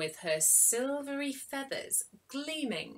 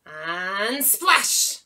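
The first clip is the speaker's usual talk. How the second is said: loud splash; 9 dB louder than usual. Acted. Sound effect in story.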